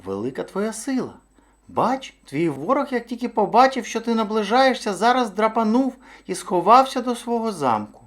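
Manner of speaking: high-pitched little hare voice; high, wily storybook hare; flattering hare. A narrator's reading. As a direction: flatter the big bear, voice held high.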